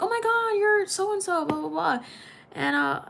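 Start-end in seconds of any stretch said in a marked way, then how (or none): 0.0-2.0 excited surprise. Retold or imitated. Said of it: "I was like".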